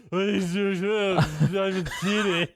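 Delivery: drawn-out, muffled mock "dry martini"; imitating movie character. Quoting the cereal box scene to co-host, drawn-out mock impression. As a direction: stretch it out slowly, hammed-up movie impression. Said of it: "cuts to him with the cereal box".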